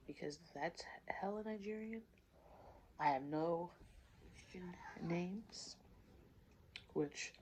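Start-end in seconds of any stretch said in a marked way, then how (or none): 0.1-2.0 whisper. Acted. Playful aside about her name. 4.3-5.8 whisper. Acted. Aside about forced names.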